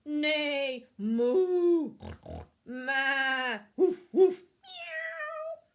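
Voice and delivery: loud neigh, moo, woof and meow; animal racket. Acted. Farm animals raising a deafening racket, loud. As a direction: bellow each animal noise.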